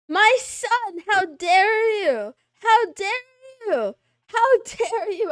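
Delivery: high, loud, slow cry of how dare you; outraged father. Pretend. Father to son who forgot him, loud and theatrical. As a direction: play a wounded father scolding his son. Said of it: "I'm your father".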